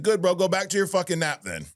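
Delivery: high, heated telling-off; reliving the argument, heated and high; retold angry snap. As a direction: snap at him like you're still mad. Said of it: "You sound angry".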